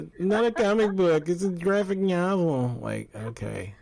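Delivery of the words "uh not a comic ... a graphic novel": mocking nerdy correction, higher and louder; nerd voice. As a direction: mimic a pedantic nerd, higher. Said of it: "Sound like a freaking nerd".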